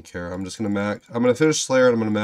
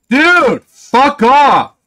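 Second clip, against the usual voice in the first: furious rant; 3 semitones higher than usual. Real, not acted. Player raging at Jagex over a failed boss, furious shouting. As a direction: vent everything at the developers.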